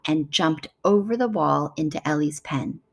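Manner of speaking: ordinary delivery, nothing marked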